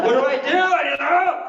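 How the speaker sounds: high, panicky imitation of losing it; mock frustrated. Brother-in-law stuck at the card machine, mock frustrated. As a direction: play someone getting worked up and stuck. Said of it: "He's getting mad".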